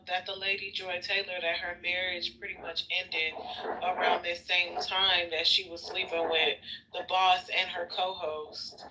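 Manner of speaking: ordinary talk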